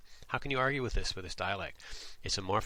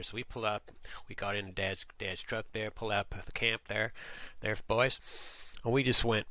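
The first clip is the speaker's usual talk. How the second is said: Carleton County dialect; 3 semitones higher, 5 dB louder than usual. Shown as a demonstration. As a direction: tell it like a local lad boasting about a wreck. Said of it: "you could say something like".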